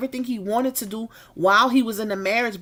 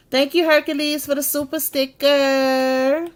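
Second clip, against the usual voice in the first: excited thanks; 5 semitones higher, 4 dB louder than usual. Real, not acted. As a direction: gush thanks to a donor. Thanking for a sticker.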